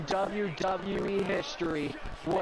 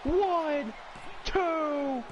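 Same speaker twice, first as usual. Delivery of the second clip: rising, much higher excited call; 12 semitones higher, slower than usual. Acted. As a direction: call the three-count with excitement. Calling the pin count.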